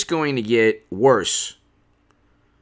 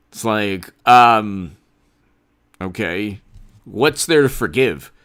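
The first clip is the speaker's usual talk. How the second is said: normal talking voice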